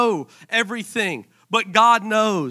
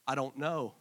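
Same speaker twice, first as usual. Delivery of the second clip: retold tearful worry; 8 semitones lower, 11 dB quieter than usual. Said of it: "I was crying, going".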